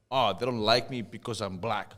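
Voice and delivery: usual speaking voice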